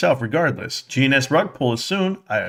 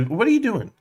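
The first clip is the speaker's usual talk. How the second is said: wife's voice; 9 semitones higher than usual. Retold or imitated. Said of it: "my wife goes".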